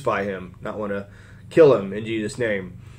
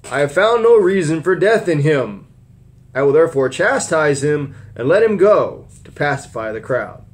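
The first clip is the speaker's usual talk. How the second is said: raised, louder Bible reading voice; scripture reading; 4 semitones higher, 6 dB louder than usual. Acted. Preacher reading Pilate's trial to the church, raised voice. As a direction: read the Gospel out to the congregation.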